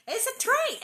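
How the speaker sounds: can you believe it, it's a train; exasperated incredulity; incredulous. Real, not acted. Incredulous about fear of trains.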